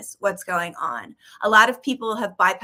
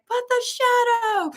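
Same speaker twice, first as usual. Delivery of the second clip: put-on much higher, slow, plaintive childlike cry; a lone kid pleading to be heard; kid in the corner. Speaker voicing her younger self among love-and-light crowd, childlike cry. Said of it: "that kid in the corner being like".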